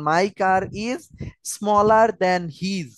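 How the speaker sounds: words spaced slowly for writing down; slow dictation. Shown as a demonstration. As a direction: read it slowly so they can write. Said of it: "এটাও already বলছি". (লেখার জন্য ধীরে ধীরে পড়া)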